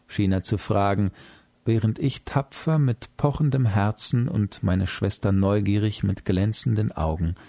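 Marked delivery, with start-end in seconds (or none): none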